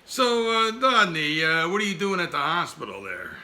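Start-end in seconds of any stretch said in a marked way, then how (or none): none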